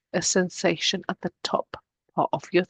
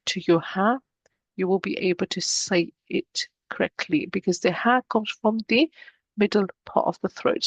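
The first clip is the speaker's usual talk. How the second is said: usual speaking voice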